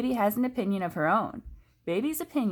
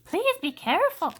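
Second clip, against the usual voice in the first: high anxious plea; worried plea; 13 semitones higher, 5 dB louder than usual. A narrator's reading. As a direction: beg sister to be careful. Baby worried for sister.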